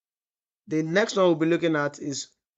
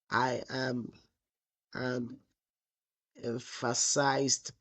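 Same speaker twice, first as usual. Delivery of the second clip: slow, lowered voice, gaps between words; typing aloud; 4 semitones lower, 7 dB quieter, much slower than usual. Real, not acted. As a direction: say it softly to yourself while typing. Dictating to self while typing.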